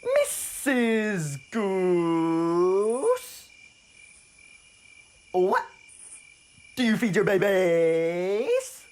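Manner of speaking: loud, high, very drawn-out frog voice; curious frog. Acted. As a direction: stretch every word into a big booming question.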